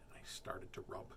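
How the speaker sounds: near-whisper, very soft; secretive, breath-only line; hushed storytelling. A narrator's reading. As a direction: drop to a whisper for suspense. Story drops to a hush.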